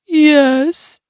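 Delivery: mild tearful storybook bear; crying bear. A narrator's reading. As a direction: admit it with a touch of a whimper. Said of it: "he says as he cries".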